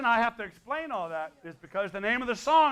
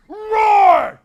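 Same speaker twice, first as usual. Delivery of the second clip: loud, high drawn-out roar; huge demonstrated roar; 13 semitones higher, 15 dB louder than usual. Shown as a demonstration. Singer shows the audience their part, shouted roar. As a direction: roar it out at full volume for them to copy. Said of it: "Here's your part".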